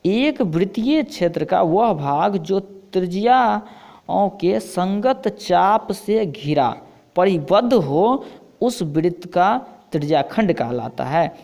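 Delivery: slow, emphatic textbook reading; measured classroom dictation; definition read-out. Acted. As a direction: read it out slowly, word by word, for students.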